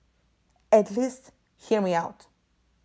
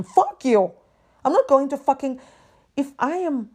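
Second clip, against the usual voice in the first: loud furious yell; angry outburst; 5 semitones higher, 5 dB louder than usual. Real, not acted. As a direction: blast it at the company. Ranting at company phone runaround.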